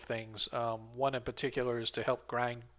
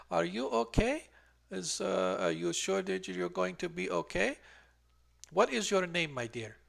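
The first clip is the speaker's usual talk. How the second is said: higher, gentle concern; caring old midwife; 5 semitones higher, 3 dB quieter than usual. Acted. Midwife distracts the player before pulling the thorn, higher and gentle. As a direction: soothe the patient while distracting her. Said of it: "looking at you very carefully".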